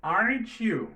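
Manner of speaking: "Aren't you" with the t and y blended into a ch sound; "aren't you" linked into "arenchu". Shown as a demonstration. English teacher models the phrase for the class, "Aren't you" as "arenchu". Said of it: "Let's go".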